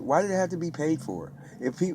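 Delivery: ordinary talk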